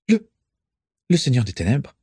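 nervous stammer, words catching. A narrator's reading. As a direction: stumble over the first word out of fear. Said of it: "bredouilla Régulus".

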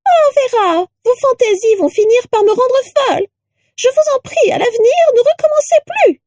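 very high, shrill pleading reproach; rattle-voiced portrait. A narrator's reading. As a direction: fret and scold in a piercing little voice. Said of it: "une voix de crécelle".

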